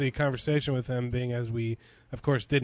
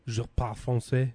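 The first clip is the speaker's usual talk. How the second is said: flat French try. Shown as a demonstration. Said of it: "I'm sure I could if I really tried".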